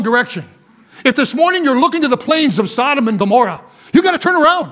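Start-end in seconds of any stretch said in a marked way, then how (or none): 3.9-4.7 urgent call. Real, not acted. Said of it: "preacher urging repentance".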